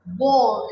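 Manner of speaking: "बोल" with a long pure oh; "बोल", one long o, no ow glide; long o sound. Shown as a demonstration. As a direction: hold a rounded o in "बोल". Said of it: "इसको बाउल नहीं बोलते हैं".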